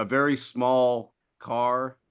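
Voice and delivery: ordinary delivery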